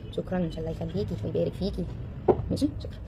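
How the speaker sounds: soft, half-whispered thanks; quiet aside. Real, not acted. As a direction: murmur thanks off to the side. Aside to someone off-mic.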